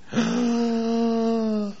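soft shocked gasp; a quiet, breathy mock gasp.

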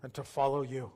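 subdued prayer in short phrases, long pauses between; slow guided prayer. Real, not acted. Pastor leading new believers through a prayer, slow and subdued. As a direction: pray slowly, leaving room for others to repeat. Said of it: "I'm gonna pray slow".

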